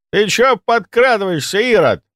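high-pitched yelp at being caught off guard; jumpy, high squawk of protest; startled squeal. A narrator's reading. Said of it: "взвизгнул он".

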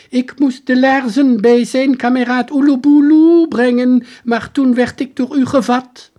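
put-on high, loud, bewildered old soldier. Soldier pleading his story to the detective, high and loud. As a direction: pitch him up high and loud as the lost soldier.

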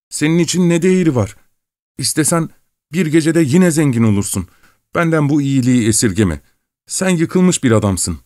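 ashamed pleading. A narrator's reading. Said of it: "utançtan titreyen bir sesle yalvarıyordu".

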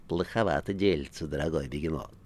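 softly poisonous aside; quietly needling a friend; quiet venom. A narrator's reading. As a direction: say it low-key with a venomous edge. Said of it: "тихо сказал Коровьев ядовитым голосом".

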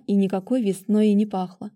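ordinary delivery, nothing marked

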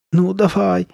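high impatient urging. A narrator's reading. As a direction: urge her on, losing patience. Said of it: "нетерпеливо проговорила она".

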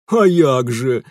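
loud, hoarse henchman voice; thug's eager assent. Acted. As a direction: back the boss up heartily. Thug Петро agrees, Ukrainian words. (громкое согласие громилы)

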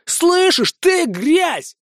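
snarled high insult; through gritted teeth. Acted. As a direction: hiss it through clenched teeth. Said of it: "процедил сквозь зубы Винсент".

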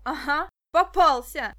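put-on boy shouting in triumph; triumphant. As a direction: crow over the catch. Stas pins the villain.

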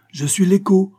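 high, loud call. A narrator's reading. Châtelain calls to the hidden child, calling out. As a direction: call it out for an echo to return. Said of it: "cria-t-il".

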